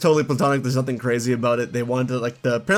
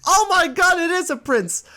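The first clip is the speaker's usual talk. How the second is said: hammed-up child thrilled by a prince; starstruck little girl. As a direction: shriek with a kid's wonder. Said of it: "she's like".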